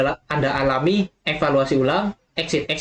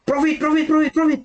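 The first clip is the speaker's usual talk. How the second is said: euphoric yelling; 10 semitones higher than usual. Retold or imitated. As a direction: chant it like a trader gone wild. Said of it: "mereka teriak". (teriakan girang bernada tinggi)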